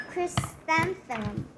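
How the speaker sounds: ordinary delivery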